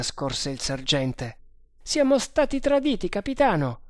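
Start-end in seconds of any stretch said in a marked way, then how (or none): 1.8-3.8 high-pitched urgent announcement; urgent report. Acted. Sergeant telling the captain of betrayal, urgent. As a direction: blurt it out up high.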